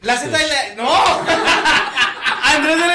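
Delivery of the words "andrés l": laughing. Real, not acted. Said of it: "mi nombre es Andrés L Z M".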